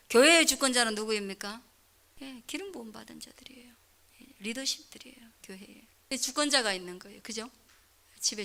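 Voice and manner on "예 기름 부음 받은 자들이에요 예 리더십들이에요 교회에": whispered answer. Real, not acted. Answering own question to congregation.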